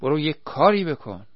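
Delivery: urgent order. A narrator's reading. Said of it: "کریم رو به وحید گفت".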